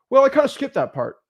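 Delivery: high-pitched mock excuse; imitated student admission. Acting out student's sheepish admission.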